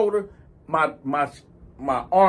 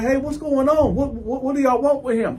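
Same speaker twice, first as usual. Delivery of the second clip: lightly voiced woman, pitched higher; concerned female friend; 6 semitones higher than usual. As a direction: step in with a higher-pitched challenge. Female friend intervenes in the story.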